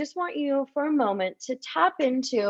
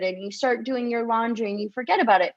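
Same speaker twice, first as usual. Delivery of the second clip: usual speaking voice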